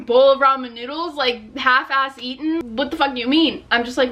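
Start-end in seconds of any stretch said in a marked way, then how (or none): none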